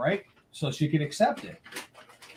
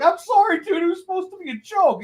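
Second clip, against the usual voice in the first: sheepish apology; 13 semitones higher than usual. Retold or imitated. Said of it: "I'm like".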